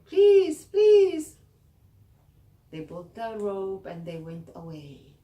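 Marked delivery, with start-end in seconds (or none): 0.0-1.4 high, very loud desperate pleas; Sarah begging the girls not to leave her. Acted. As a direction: beg at the top of your voice. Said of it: "begging them".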